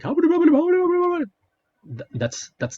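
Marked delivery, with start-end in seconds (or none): 0.0-1.2 much higher, louder garbled babble; mock a drowning man's gurgling rant; De Niro sinking. De Niro going down with the boat in Cape Fear, high mock babble. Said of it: "and he's like".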